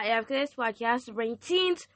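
ordinary talk, nothing marked

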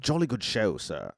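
put-on compliment it in a British accent; British butler. Butler approving his master's TV choice, British and jolly. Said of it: "he's an English robot butler".

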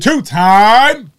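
high, loud interjection; hype shout. Pretend. Co-host hyping the champion's titles, loud hype shout.

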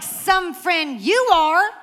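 loud, high mock-offended retort; hammed-up sarcastic jab. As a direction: throw it back, indignant. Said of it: "And I'm like".

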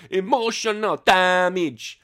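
loud, slow shouted catchphrase; meme catchphrase. Retold or imitated. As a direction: bellow it out like the meme. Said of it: "If you don't know what that is, Google".

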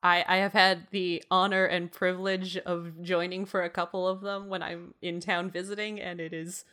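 ordinary delivery

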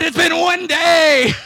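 slow, loud, high disbelieving shout; outraged shout. Retold or imitated. To friends about the one-day breakup, outraged shout. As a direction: yell it like you can't believe it.

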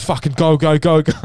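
loud, high urging; re-enacted frantic shouting; retold urgent shout. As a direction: yell it as if hurrying everyone downstairs. Retold sprint down the stairs.

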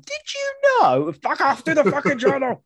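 high silly put-on voice; mock squeaky voice. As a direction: squeak it out like a cartoon. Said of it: "mock voice".

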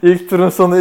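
loud, high mocking repeat; teasing loud quote. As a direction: throw his words back at him. Teasing cohost with his words.

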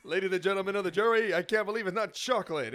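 hammed-up lawyer's proclamation; mock courtroom lawyer. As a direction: declaim it like a closing argument, laughing. Mock address to a jury.

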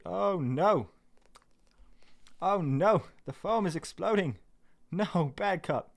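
high, playful dismay; mock dismay. Scolding a cup as its foam explodes, in high mock dismay.

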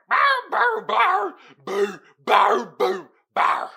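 high, loud mock dog barks; loud imitation of dogs barking; imitated barking. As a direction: bark like the neighbor's dogs.

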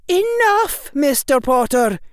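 very high, loud stern professor; sharp reprimand. A narrator's reading. Professor silencing her student, sharp and loud. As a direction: cut the boy off loudly and high.

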